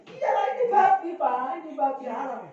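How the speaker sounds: high-pitched, emphatic arguing; acted-out heated protest; insistent retort. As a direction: insist on it, voice jumping up. Acted argument over forbidden pork.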